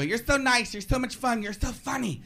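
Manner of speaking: put-on high, gushing girl voice; imitated girl. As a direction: lightly mimic a girl handing out compliments. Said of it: "Some girl come up to me and go".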